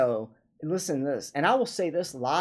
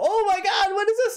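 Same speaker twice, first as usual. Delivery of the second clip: amazed; 13 semitones higher than usual. Retold or imitated. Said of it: "they go".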